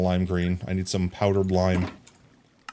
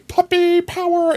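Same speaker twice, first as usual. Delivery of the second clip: very high, loud little squeal; squeaky cheer; 7 dB louder than usual. Pretend. Streamer echoing chat's puppy power, squeaky cheer. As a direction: pipe it up like a tiny mascot's battle cry.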